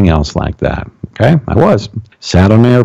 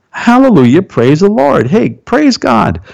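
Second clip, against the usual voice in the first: high-pitched hallelujah exclamation; jubilant praise; 11 semitones higher, slower than usual. Pretend. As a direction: cheer it out, drawing the praise high. Exclaiming praise after reading testimony.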